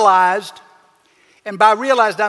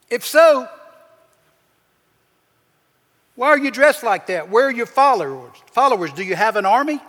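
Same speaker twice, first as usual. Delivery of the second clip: higher, arrogant mocking disbelief; incredulous sarcasm; 3 semitones higher, 3 dB louder than usual. Pilate mocking Jesus as a king, higher sarcastic scoff. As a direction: scoff at the prisoner's claim. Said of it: "he was really incredulous".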